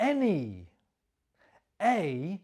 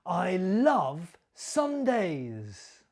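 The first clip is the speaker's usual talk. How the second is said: slow, with LOVE stressed and a falling end; slower than usual. Shown as a demonstration. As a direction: lean on "love" and let the line fall slowly. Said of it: "Did you copy my stress and my intonation".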